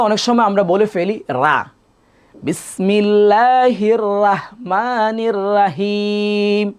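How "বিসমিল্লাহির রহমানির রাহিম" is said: বিসমিল্লাহির রহমানির রাহিম drawn out slowly, each syllable held; slow careful recitation. Shown as a demonstration. Teacher reciting slowly to show the র sound. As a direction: recite it slowly like a model reading. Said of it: "এই উচ্চারণটা ভুল".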